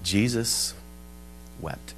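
very soft, low, pause between words; hushed and solemn, word by word; simple, solemn. Retold or imitated. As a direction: offer the two words quietly. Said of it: "were very simple".

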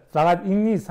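usual speaking voice

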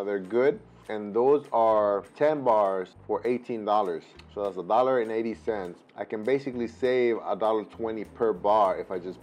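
chewing while talking; eating a snack bar; mouth full. Real, not acted. Seen 0: Hand to mouth at 0.4, then closed-lip chewing between words.

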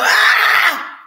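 mock startled cry. Acting out startled reaction.

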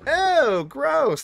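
high disgusted wail; grossed-out cry. Real, not acted. Disgusted at game monster.

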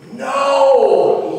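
high, loud, drawn-out shout; shouted rebuke; emphatic yell. Acted. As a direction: yell a long, emphatic refusal. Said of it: "he yells at them".

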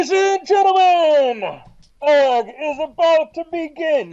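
big, high, drawn-out ringside shout; arena announcer. Acted. Game master as announcer opening the fight, shouted grandly. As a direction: boom it out slowly to a packed arena.

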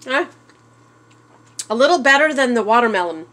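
talking while chewing something sour; eating sour food. Real, not acted. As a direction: mouth full of sour snack. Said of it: "oh, that's sour".

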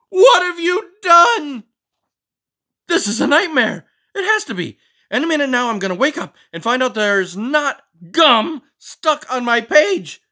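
high, loud indignant scolding voice; fussy book character. Acted. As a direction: scold the reader for snacking. Character panics over gum.

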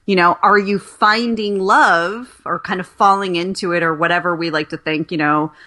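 ordinary talk

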